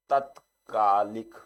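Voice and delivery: तत्कालिक said very slowly, syllable by syllable; तत्कालिक dictated slowly as the correct spelling. Shown as a demonstration. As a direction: draw out तत्कालिक so each sound is clear. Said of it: "ये आपका शुद्ध word हो गया".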